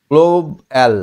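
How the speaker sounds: গ্লোব এল as two separate beats; split syllables. Shown as a demonstration. Teacher modelling pronunciation for pupil, split syllables. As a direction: break the word after গ্লোব, then এল. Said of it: "এরকম করে উচ্চারণ করলে".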